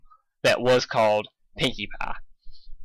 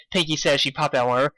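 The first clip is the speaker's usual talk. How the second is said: ordinary delivery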